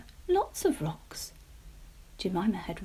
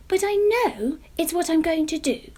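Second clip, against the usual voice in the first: high, eager little pumpkin voice; a hopeful young pumpkin sharing her dream; Jemima pumpkin voice; 9 semitones higher, 8 dB louder than usual. Acted. Jemima tells Mister Munro her plan, high and eager.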